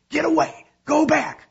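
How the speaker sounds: urgent high, loud shouts; yelled order. A narrator's reading. Uncle orders the rescuer away from danger, yelling. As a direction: bellow a warning out over the rapids. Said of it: "yelled Uncle Reuben from Leon's boat".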